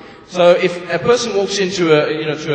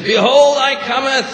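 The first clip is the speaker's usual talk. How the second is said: higher, louder pulpit proclaim; preacher's proclamation; 7 semitones higher, 5 dB louder than usual. Acted. Preacher reading Revelation to the congregation, louder higher proclaiming. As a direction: boom it out from the pulpit. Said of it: "No, more emphasis".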